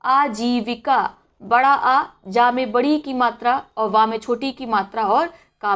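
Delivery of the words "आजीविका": आजीविका said very slowly with a long opening आ; correct pronunciation. Shown as a demonstration. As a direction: draw out आजीविका, stretching its first आ. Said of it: "सही क्या उच्चारण होगा".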